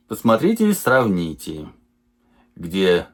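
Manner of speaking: ordinary talk, nothing marked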